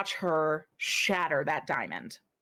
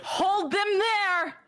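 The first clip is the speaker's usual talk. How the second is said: high slow ordering cry; smith commanding; 14 semitones higher, 3 dB louder, slower than usual. Acted. Smith Lildrir ordering the player to hold the fish, high command. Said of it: "she goes".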